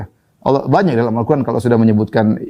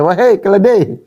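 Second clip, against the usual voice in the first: angry insult; 8 semitones higher than usual. Retold or imitated. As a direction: snap the insult at a lazy son. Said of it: "akhirnya dia marah". (bentakan marah, tinggi dan keras)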